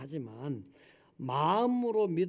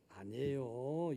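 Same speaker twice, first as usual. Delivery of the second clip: ordinary talk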